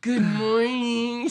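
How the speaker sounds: slow, high, loud sing-song good morning; sing-song greeting. Retold or imitated. Greeting ex after blackout night.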